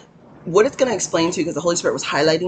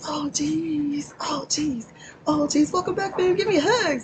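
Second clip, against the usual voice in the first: playful squeaky voice; 11 semitones higher than usual. Pretend. Playful greeting to old fans.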